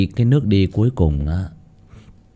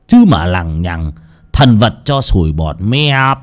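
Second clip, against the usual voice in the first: gloating villain; 3 semitones higher, 6 dB louder, slower than usual. Acted. Lão Tuân confessing to dying Thầy Nghiêm, gloating and menacing. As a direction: relish the confession, bursting into loud high outbursts.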